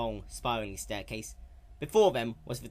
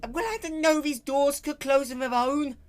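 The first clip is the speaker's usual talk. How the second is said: loud, high indignant shout; raised frustrated shout; 8 dB louder than usual. Acted. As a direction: yell it through the bars. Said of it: "Rainbow shouted from behind the bars".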